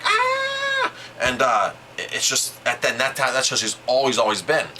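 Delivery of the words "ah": sudden high-pitched mock shriek of alarm; panicked shriek. Son imitating his anxious mom freaking out, high mock shriek. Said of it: "my mom is the kind of person that's like".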